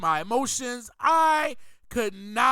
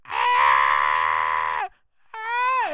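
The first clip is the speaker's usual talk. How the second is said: drawn-out mock baby scream; imitated pained scream. Preacher imitates baby expressing pain, mock scream. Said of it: "express the pain that they're".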